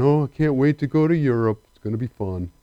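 mock-excited words said flat and glum; gloomy Eeyore tone. Preacher teases the congregation for dull travel talk, glum and flat. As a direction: sound like a glum donkey pretending to be thrilled. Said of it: "Are you traveling on Eeyore Airlines?".

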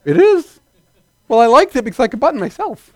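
high, fast, surprised bride; flustered bride. Retold or imitated. Bride answers friend about her backward dress, high and flustered.

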